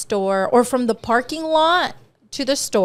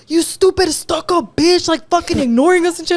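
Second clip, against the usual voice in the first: raised, high mock rant; retold angry insult; mad catcallers; 7 semitones higher, 4 dB louder than usual. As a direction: imitate rejected catcallers cussing someone out. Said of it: "they got more mad because I ignored them".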